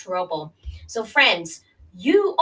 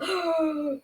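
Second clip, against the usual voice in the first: mock gasp; 5 semitones higher, 3 dB quieter than usual.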